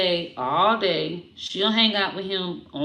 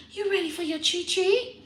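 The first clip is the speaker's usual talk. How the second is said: high rising pet voice; high teasing pet voice; 8 semitones higher than usual. Real, not acted. Repeated in baby talk.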